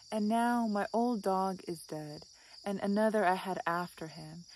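measured, even recital with pauses; literary reading. Acted. Vlogger reads Mary Oliver on a dead dog, measured literary recital.

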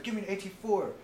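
high-pitched exasperated order; retold pissed-off demand. Narrator looking down at his gunner, pissed-off demand. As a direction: demand it as if out of patience. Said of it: "I was so fucking pissed off".

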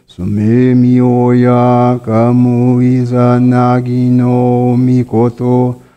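normal talking voice

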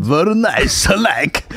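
praising the snacks in a mock Polish accent. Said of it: "Polish shit".

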